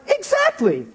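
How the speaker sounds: squealed, very high and excited; a high, gleeful yelp of yes; delighted agreement. Retold or imitated. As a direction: shriek it out in thrilled agreement. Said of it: "I was like".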